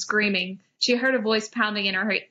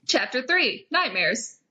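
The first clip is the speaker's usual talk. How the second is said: usual speaking voice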